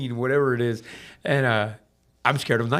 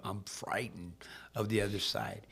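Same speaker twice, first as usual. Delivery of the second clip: whispered and softer; whispered fear; 4 semitones lower, 10 dB quieter than usual. Real, not acted. Admitting his fear to the host in a whisper. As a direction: let the fear drop to a whisper. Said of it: "I am a frightened fucking man".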